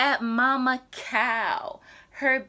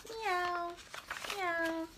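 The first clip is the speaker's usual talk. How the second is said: high, soft mewing; kitten meow; 8 semitones higher, 9 dB quieter than usual. Retold or imitated. Reading to a toddler, high soft kitten meows. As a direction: mew like a tiny kitten. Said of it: "What sound do her baby make?".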